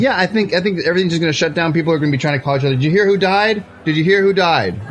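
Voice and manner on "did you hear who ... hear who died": higher-pitched imitation of frantic callers; mock urgent news-spreading. Guest imagining callers after his death, mock urgency. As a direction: pass on shocking news in pretend urgency. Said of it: "Like that".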